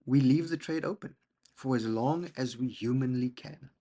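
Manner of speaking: ordinary delivery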